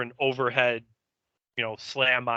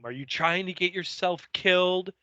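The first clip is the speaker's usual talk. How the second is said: high-pitched mock yell; 7 semitones higher than usual. Recapping Buffy yelling at Angel, mild mock yell pitched high. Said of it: "she starts yelling back at him".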